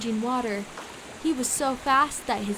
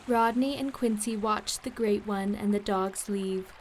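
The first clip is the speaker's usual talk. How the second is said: ordinary delivery, nothing marked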